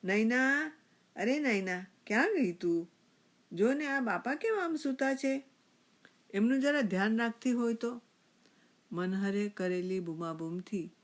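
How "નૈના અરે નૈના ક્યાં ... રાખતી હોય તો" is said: loud, raised-pitch call into the house. A narrator's reading. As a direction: shout for her, scolding as you go. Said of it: "તેણે બૂમ પાડી".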